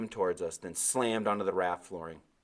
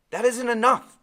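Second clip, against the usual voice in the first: high, loud whiny complaint; complaining; 13 dB louder than usual. Acted. Thirsty boy complaining to Timothy, loud and whiny. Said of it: "I complained".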